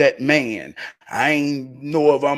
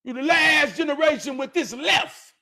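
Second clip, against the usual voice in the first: excited shout; 13 semitones higher than usual. Real, not acted. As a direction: shout your admiration out. Praising Junebug to crowd.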